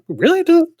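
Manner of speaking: high-pitched questioning "really", then easing down; acting out mild disbelief at a friend; retold sceptical comeback; mildly incredulous retort. Acts out own doubtful reply to friends.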